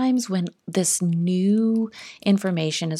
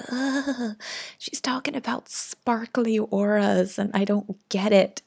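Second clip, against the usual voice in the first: lightly imitated exasperated groan and complaint; mock disgusted; 4 semitones higher than usual. Recalling her old reaction to sparkly auras, mock-exasperated. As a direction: act out mild past bafflement. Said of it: "when I was like".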